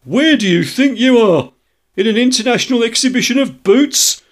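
indignant shopkeeper building to a high, loud rant; growing angrier. A narrator's reading. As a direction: escalate from affronted questions to shrieking outrage. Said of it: "grow more angry as they proceed".

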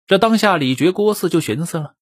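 plain reading voice

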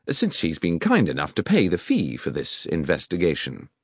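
crisp orders from a lightly voiced Holmes; Holmes instructing. Holmes gives orders to Hopkins.

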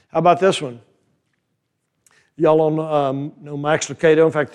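ordinary talk, nothing marked

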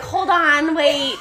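mock protest. Co-host acting out what he never said, mock protest. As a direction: call it out through laughter.

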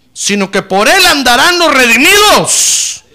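high, loud, ringing scripture proclamation; fiery preaching. Acted. Pastor reads the promise to the congregation in full preaching voice. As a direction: lift the verse up over the crowd.

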